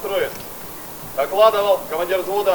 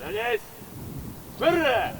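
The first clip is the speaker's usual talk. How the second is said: shouting drill sergeant; 9 semitones higher, 8 dB quieter, slower than usual. Real, not acted. Military formation drill commands.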